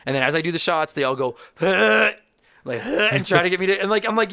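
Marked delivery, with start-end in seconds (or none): 1.6-3.1 loud, high wordless grunt; imitated crowd noise. Drinkers egging on the comic, imitated loud noise. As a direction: mimic the crowd's noise as shots go down.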